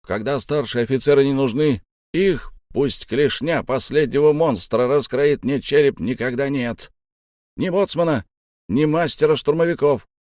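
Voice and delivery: high, emphatic grumbling voice; complaining shorty. A narrator's reading. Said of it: "пожаловался коротышка".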